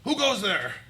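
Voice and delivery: high, loud challenge from a sentry; guard's challenge. Acted. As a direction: call out sharply to an intruder. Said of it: "and are like".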